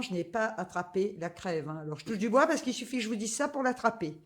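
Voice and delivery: ordinary talk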